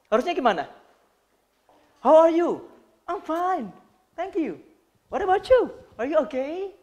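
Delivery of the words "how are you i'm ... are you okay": bright, lively high greeting exchange; cheerful greeting demo. Shown as a demonstration. Trainer demoing a cheerful how-are-you exchange, upbeat and high. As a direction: sing out the small talk warmly and upbeat. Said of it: "harus keluarin suara kan baik juga".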